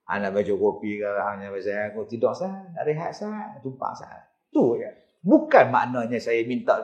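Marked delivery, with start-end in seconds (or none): 0.0-4.3 hushed, humble asking for lodging; meek quiet request fading to a whisper; polite soft plea. Retold or imitated. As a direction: ask the spirit softly, almost whispering at the end. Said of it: "Saya kata kat dia".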